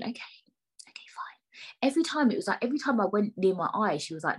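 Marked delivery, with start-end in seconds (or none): 0.0-1.9 slow, breath-only whisper; whispered giving-in. Retold or imitated. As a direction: give in under your breath. Acts out giving in, in thought.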